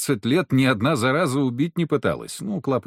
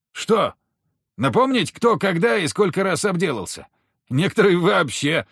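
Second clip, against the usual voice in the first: higher-pitched woman, needling and scolding; женщина-охотница, язвительная; 8 semitones higher than usual. A narrator's reading. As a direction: take him to task in a raised female voice. Narrator voices female hunter rebuking. (язвительный женский голос, с укором)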